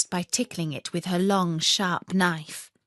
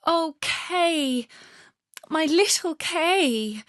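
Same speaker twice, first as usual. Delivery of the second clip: sobbing; 9 semitones higher, slower than usual. Acted. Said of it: "sobbed Gerda".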